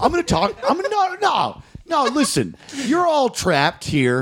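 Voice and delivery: high, overwrought mock comic; mock stand-up. Pretending to be a desperate sad comic on stage, high.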